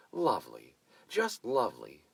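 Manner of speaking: loud, bright gushing praise; gushing delight. A narrator's reading. Hostess Mavis gushing at the posing couple, loud and delighted. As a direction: gush over the couple like a proud hostess. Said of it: "Mavis declared".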